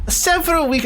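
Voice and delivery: high, hammed-up English accent; badly faked posh-ish English. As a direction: attempt a prissy English accent. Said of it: "trying to do a cunty English accent".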